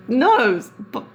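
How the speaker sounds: playfully demanding, voice jumping up; retold mock protest. Retold plea to her friend.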